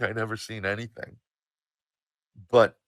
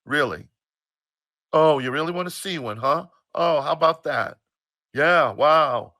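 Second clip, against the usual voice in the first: loud, mocking mother's indignation; bent out of shape; 4 semitones higher, 8 dB louder than usual. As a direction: fire back in loud, put-out sarcasm. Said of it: "kinda got bent out of shape".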